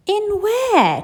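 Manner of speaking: high eager cry; cried. Acted. Charlie questioning Grandpa Joe, high eager cry. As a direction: cry it out curious. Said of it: "cried Charlie".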